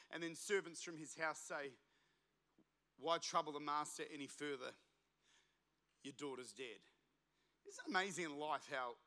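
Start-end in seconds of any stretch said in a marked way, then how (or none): 3.0-6.8 much softer, lower, fading to near silence; quiet and grave. Retold or imitated. Servants tell Jairus his daughter died, softly and gravely. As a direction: let the bad news drop softly.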